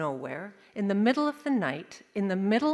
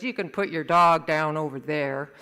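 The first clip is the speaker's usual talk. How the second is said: low, loud character voice; gnarly old-oak guy; 5 semitones lower, 6 dB louder than usual. Acted. Gnarly man to family with injured dog, low-voiced. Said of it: "gnarly oak tree kind of a guy".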